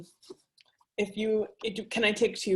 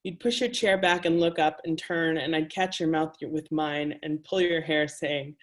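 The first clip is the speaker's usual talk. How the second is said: measured, intimate poetry reading; reading her own words to a live audience; reading aloud. A narrator's reading. Author reading her erotic prose to an audience, intimate and measured.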